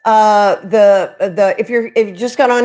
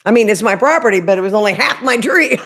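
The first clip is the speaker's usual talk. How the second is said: ordinary talk, nothing marked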